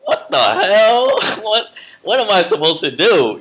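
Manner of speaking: stunned disbelief. Retold or imitated. After the driver slaps him, high laughing disbelief. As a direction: squeal it, still not believing it. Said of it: "And I'm like".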